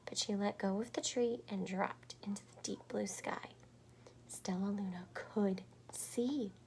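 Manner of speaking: soft whispered telling; whispered storybook narration. A narrator's reading. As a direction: hush as she drops into the sky.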